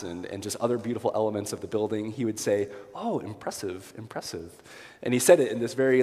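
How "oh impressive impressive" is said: put-on soft, polite New Zealand accent, softer than his own voice; a light imitation of a New Zealand bishop's soft "impressive". Imitating a visiting bishop's soft New Zealand accent during a church tour. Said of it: "charming New Zealand accent".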